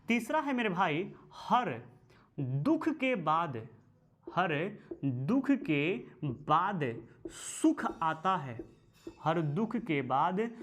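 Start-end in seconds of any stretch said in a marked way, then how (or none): 2.4-10.5 very slow, stretching दुख; drawn-out teacher dictation. Shown as a demonstration. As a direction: spell it out slowly for note-taking. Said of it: "dictating the third sukta".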